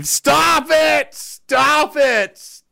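high, loud shout of protest; frustrated yell. Real, not acted. Shouting at chat trolling him, exasperated yell.

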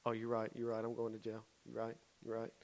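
soft, low, conceding voice; subdued backing down. Retold or imitated. Teen realizing he would go to jail, softly backing down. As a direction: concede quietly, deflated. Boy backs down, disarmed.

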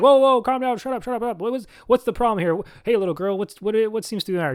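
high, hurried peacemaker voice. Retold or imitated. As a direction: break up the kids' teasing, fast and high. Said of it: "And he's like".

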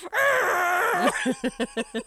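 loud high frustrated roar; frustrated yell. Retold or imitated. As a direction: let out a fed-up yell. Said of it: "young Thomas is just like".

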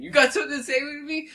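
very high, loud challenger voice; mock confrontation. Fisherman confronting the worker at the hilltop, mock confrontation. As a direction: imitate a worked-up man picking a fight.

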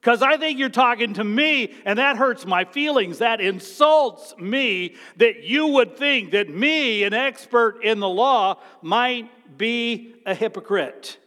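a hint of an indignant Pharisee; offended lawyer. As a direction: play the insulted expert, wounded pride. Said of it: "here's what he said to Jesus".

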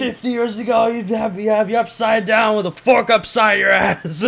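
Kramer impression. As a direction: rant at the crowd like Kramer. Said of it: "recite the Kramer thing".

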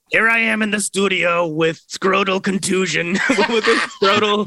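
slow talk-show host voice, cracking up; talk-show host voice breaking into laughter; Larry King, laughing. Retold or imitated. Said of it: "I think we could do a Larry King".

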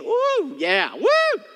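high, loud mock cheering; mock whoop. Corinth church bragging about its band, high mock whooping. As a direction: hoot like fans at a concert. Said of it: "the church in Corinth is like".

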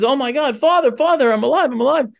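high, thrilled exclamation, retold; high-pitched mock amazement; excited Pinocchio. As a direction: act out an overjoyed boy calling to his father. Acting Pinocchio discovering he is alive.

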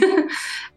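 little breathy laugh. Real, not acted. Laugh after rescue memory.

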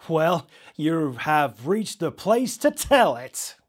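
slow, loud, drawn-out radio host; dramatic DJ voice. Acted. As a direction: stretch it out like a showman. DJ invites caller's story.

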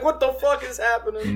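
high-pitched laughing disbelief; amused, high mock fright; laughing mock alarm. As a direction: retell your panic through giggles. Laughing retelling of a scare.

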